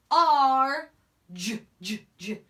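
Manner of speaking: Ch, ch, R, j, j split into separate sounds; teacher sounding out charge slowly. Shown as a demonstration. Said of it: "I'm gonna slow down there".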